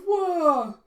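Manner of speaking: a sharp, high alarmed shout; startled whoa. Retold or imitated. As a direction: thrown off balance, yelling. Jeff nearly hits rock.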